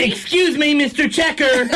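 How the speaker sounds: tattletale voice. Pretend. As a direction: call out to the checker in a high voice. Playing tattling customer.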